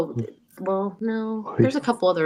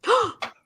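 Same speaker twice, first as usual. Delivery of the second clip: sharp, high, hammed-up gasp; a theatrical offended gasp; mock gasp. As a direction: gasp in pretend shock.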